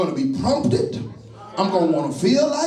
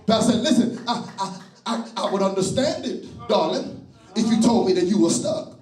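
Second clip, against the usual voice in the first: full preaching; 4 semitones higher, slower than usual. Real, not acted. Preacher rousing the congregation to testify, full force whoop. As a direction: shout it out over the organ.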